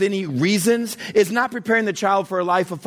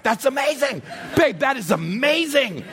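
high, emphatic mock-proud gushing; amazed praise; 6 semitones higher than usual. Proud dad marvels at son's rant.

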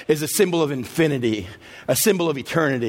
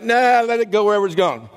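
reluctant; 6 semitones higher, 3 dB louder than usual. Acted. Moses refusing, voiced.

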